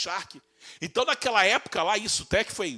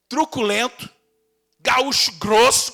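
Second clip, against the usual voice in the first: louder, slow, heavy gaúcho grunt; gruff mock gaúcho; 4 semitones higher, 8 dB louder, slower than usual. Preacher caricaturing a rough gaúcho, loud and slow. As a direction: growl it like a rough cowboy.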